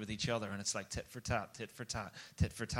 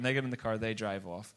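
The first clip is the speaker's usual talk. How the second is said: usual speaking voice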